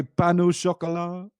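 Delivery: French accent. Shown as a demonstration. Podcaster proving what French they can say, in French accent. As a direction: show off a put-on French accent. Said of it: "is the best accent".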